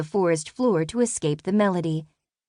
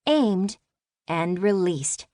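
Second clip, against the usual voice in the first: ordinary delivery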